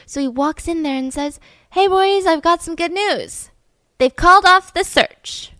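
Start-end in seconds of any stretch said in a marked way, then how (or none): 1.7-5.5 higher, drawn-out upbeat call; cheerful announcer of news. Retold or imitated. Survivor walking in on his teammates, mock-cheerful announcement. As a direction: call it out like good news, slowly.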